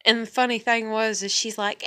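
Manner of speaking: ordinary delivery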